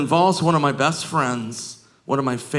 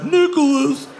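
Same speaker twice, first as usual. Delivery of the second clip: put-on drunk partygoers; 11 semitones higher, 4 dB louder than usual. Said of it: "They were like".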